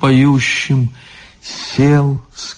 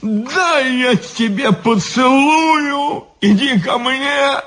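put-on drunken roar; 13 semitones higher than usual. A narrator's reading. Drunk guard calling his friend over, maudlin roar. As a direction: cry it out high with drunken affection. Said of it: "заревел стражник".

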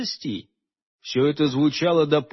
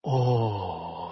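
low breathy sigh of longing; soft sigh; 9 semitones lower, 7 dB quieter than usual. A narrator's reading. Morgana sighing in Holger's arms. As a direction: sigh it out softly. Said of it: "вздохнула она".

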